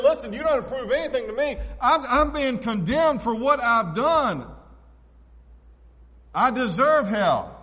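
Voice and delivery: loud, slow, anguished admission; thief's confession. Acted. Thief on the cross confessing he deserves hell, loud and slow. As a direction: cry out your guilt from the cross. Preacher voices repentant thief.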